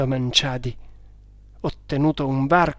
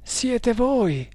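breathless; 9 semitones higher than usual. A narrator's reading. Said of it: "esclamò affannosamente il bengalese".

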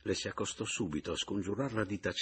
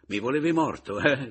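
raised, biting challenge; bitter accusation; 4 semitones higher, 7 dB louder than usual. Acted. Returned husband accuses weeping wife, bitter. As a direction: confront her, pitch and volume up.

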